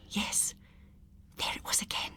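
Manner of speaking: breathy tense whisper; barely voiced, holding breath. A narrator's reading. Tense moment, hushed narration.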